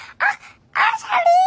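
very high mock-cute squeal; playful high-pitched fangirl-style imitation; imitating cute calling. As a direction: call out in an exaggerated, high, cutesy voice. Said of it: "ทำไมกูพูดไม่น่ารักเหมือนเขาวะ".

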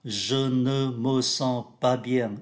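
slow, careful French for learners; slow textbook French; slow model reading. Shown as a demonstration. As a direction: read it slowly, syllable by syllable. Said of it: "Em cảm thấy không khỏe trong người".

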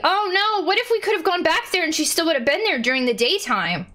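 high-pitched, alarmed outburst; panicky, high voice settling slightly; dismayed realisation. Real, not acted. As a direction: blurt it out in sudden dismay. Realises they forgot to visit Aubrey.

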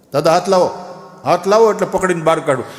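urgent loud call to a fallen grandpa. Acted. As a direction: shout down to the old man to grab hold. Calling down into the well.

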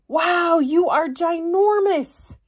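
high awestruck sprout; awed wow. Acted. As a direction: gaze up at a giant tree. Sprout awed by tall tree.

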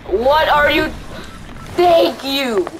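ordinary talk

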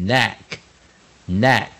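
"neck" starting straight on N, no K sound; silent K. Shown as a demonstration. Teacher showing the KN rule, "neck" with silent K. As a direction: drop the K and begin "neck" with N. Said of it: "K是不发音的".

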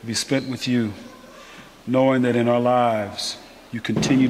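hushed earnest prayer voice. Acted. Opening prayer.

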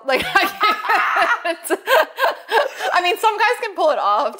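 high laughing disbelief. Real, not acted. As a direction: laugh through the words. Laughing at baby girl.

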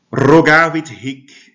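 loud, high, slow Latin recitation; reading Latin aloud. Real, not acted. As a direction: declaim the Latin phrase for the class. Teacher reads Latin text aloud.

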